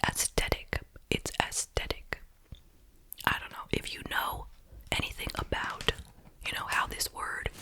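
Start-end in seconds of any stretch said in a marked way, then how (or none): 0.1-2.2 "aesthetic" whispered as as-TET-ic, hard T for TH; trendy pronunciation. Retold or imitated. Mimicking the trendy word, "aesthetic" as as-TET-ic. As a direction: say "aesthetic" both times without the TH. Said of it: "And it was just like".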